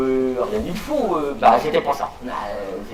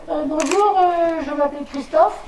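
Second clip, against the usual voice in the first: put-on high little boy voice; child's voice; 11 semitones higher than usual. Comic recalls himself as a pupil, high child voice. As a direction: pipe up like a shy little boy. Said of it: "ne riez pas parce que j'avais pas la même voix".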